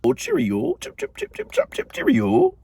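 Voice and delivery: a high, hammed-up British accent; mock British accent. Mocking a British roommate in a high, hammed-up British accent. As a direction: play a cartoon Brit, sing-song and silly. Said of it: "Maybe that's not how British people sound".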